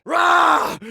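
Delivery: high, loud cartoon roar; explosive villain growl of frustration; frustrated roar. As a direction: burst out with a short, raging yell.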